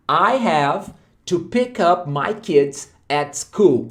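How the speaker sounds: very slow, careful English sentence; slow model sentence. Shown as a demonstration. Teacher models the new sentence slowly for students. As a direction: spell it out slowly for the learners. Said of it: "Olha só".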